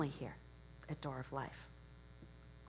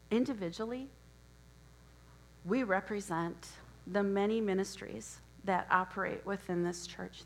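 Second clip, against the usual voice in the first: usual speaking voice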